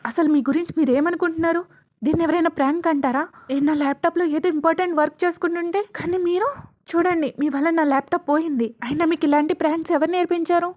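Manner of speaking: furious telling-off, high and loud; angry shouting. Acted. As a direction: burst out and scold them loudly. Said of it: "వాళ్ళ మీద అరవడం start చేస్తాడు".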